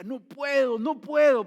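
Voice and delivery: high, loud, desperate cry of no; anguished refusal. Retold or imitated. Brother refusing to forgive his brother's killer, anguished cry. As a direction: cry out that you cannot forgive.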